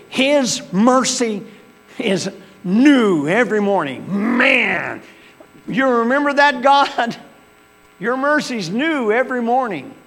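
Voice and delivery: high, loud fervent preaching; high, loud praise of mercy. Acted. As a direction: preach it with full fire. Preaching at full force.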